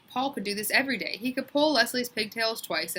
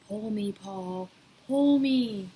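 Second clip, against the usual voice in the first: 1 tiny tempting voice; 2 semitones higher, much slower than usual. A narrator's reading. Pigtail's little voice tempting Paul.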